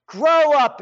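high, loud scolding command; scolding shout. Real, not acted. Ranting host scolds everybody to grow up, loud and high. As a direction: bark it at them like a fed-up parent.

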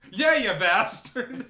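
very loud, high, slow blurt; hammed-up late comeback, voice jumping up; mock delayed reaction. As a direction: imitate a belated outburst, laughing. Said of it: "his delayed reactions are just like".

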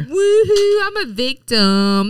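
hammed-up playing the victim; mock victim. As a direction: taunt with a sing-song self-pity act. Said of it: "you playing the victim".

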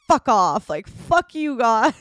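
loud frustrated swearing at a suitcase; frustrated outburst. Retold or imitated. Kicking her packed luggage, she swears at it in loud frustration. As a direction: snap it out while kicking the bag.

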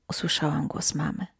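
ordinary delivery

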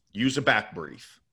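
ordinary talk, nothing marked

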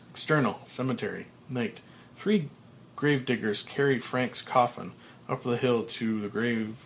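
plain reading voice

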